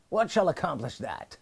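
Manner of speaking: ordinary talk, nothing marked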